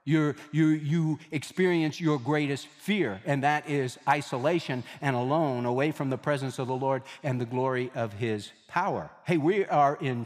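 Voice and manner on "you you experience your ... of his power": ordinary talk, nothing marked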